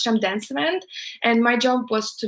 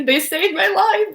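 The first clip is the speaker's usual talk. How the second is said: grateful; 9 semitones higher, 5 dB louder, slower than usual. Real, not acted. Grateful exaggeration.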